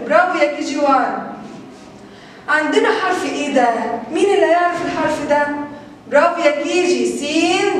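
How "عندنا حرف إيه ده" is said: ordinary talk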